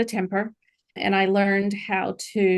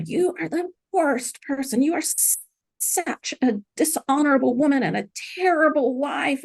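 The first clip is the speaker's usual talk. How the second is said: imitating the husband's berating at a higher pitch; retold husband's screaming; 7 semitones higher than usual. Wife retelling husband's insults in the car, mock berating. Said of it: "just started screaming at me".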